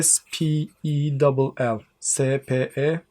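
letters said one by one, slowly; spelling letter by letter. Shown as a demonstration. Teacher demonstrates spelling letter by letter. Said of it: "spell ifadesi".

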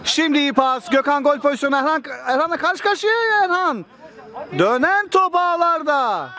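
high, fast building goal call; rising excitement to the goal; screaming football commentator. Real, not acted.

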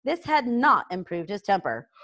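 usual speaking voice

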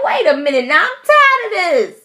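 very high, loud exasperated yell; fed up, let it burst out; frustrated yelling. Retold or imitated. Fed up with another bug showing up, high exasperated yell. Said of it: "I was so frustrated".

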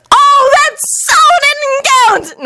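high, drawn-out indignant squeal; heated high-pitched comeback; hot retort. A narrator's reading. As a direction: protest hotly in a very high boy voice. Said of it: "Naruto replied hotly".

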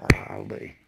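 whispered golly. Real, not acted. Exasperated aside.

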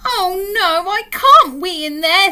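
high, loud bear protest; dismayed little bear. Acted. Alan refusing the dolly's toilet, dismayed bear.

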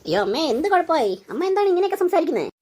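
high, startled disbelief; shocked daughter-in-law. Acted. Daughter-in-law startled by mother-in-law's apology, high and alarmed. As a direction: cry out in alarm at her mother-in-law's words.

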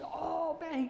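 high, elated exclamation; retold burst of delight; overjoyed. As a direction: act out a friend thrilled like a kid. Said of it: "jump up and down like a kid".

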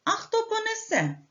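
high rooster's question; rooster asking. Acted. Rooster asks who will carry the grain, high. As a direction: ask as the hard-working rooster. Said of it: "запитав півник".

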